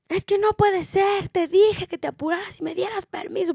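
high loud imitation of an angry older woman; mock scolding lady. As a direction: imitate the lady picking a fight.

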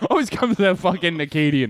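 normal talking voice

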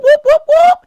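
high, loud siren imitation; siren whoops. Imitating the motorcycle police sirens, high and loud. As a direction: mimic the police sirens wailing.

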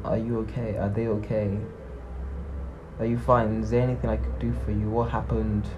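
soft, caring questions asked low and kind; low gentle voice. Shown as a demonstration. Vlogger showing the kind way to check on someone, low and gentle. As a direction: ask them softly, like you'd offer a hug. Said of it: "I'm talking in a very low".

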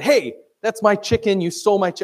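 indignant owner, lightly hammed up; mock accusation. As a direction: call out a thief, half in play. Acts out owner catching a thief.

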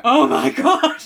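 loud, high wailed exclamation; hammed-up tearful outburst; mock crying. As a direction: wail it in mock despair. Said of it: "like, crying".

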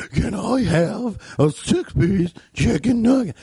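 slow, high, warbling imitation; weird customer voice. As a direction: draw the order out in an odd wobbly voice. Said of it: "get that voice".

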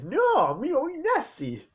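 high, loud shocked protest; high outraged cry; outraged squeal. Real, not acted. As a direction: squeal in disbelief. Outraged at the scene.

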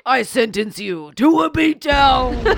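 booming slow judge shout. Pretend. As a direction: bellow it like a brawling judge. Acting out the judge character.

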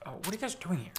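a half-hearted, quiet Joe Rogan take; bad Joe Rogan. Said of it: "That's the worst Joe Rogan".

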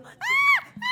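high piercing scream; startled yelling scream; retold scream. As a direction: shriek at the top of your lungs. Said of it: "Y comenzaba a gritar".

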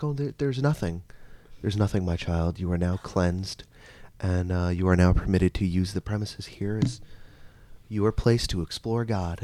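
soft, measured mock priest; lightly voiced priest absolution; shitty priest voice. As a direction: pronounce it quietly, with priestly pauses. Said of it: "a shitty priest voice".

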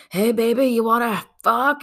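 mock pickup line. Man to woman in a movie, mock come-on. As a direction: proposition her as a hammed-up movie guy.

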